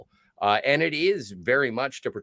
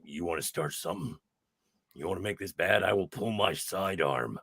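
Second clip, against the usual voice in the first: low, hushed imitation of a threatening man; understated tough-guy threat, pitched down; quiet menace; 6 semitones lower, 5 dB quieter than usual. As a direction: drop low and soft, retelling a threat. Voices Roy's threat in the story.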